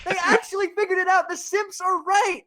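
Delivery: very high, excited squeal of mock revelation. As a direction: squeal it like a sudden genius discovery. Mock epiphany about simps, squealing.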